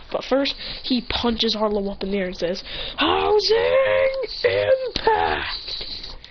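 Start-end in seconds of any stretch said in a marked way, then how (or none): 3.0-6.2 very drawn-out high strained yell; hammed-up battle shout; anime attack cry. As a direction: call out your finishing move, stretching every word. Shouted attack name while punching.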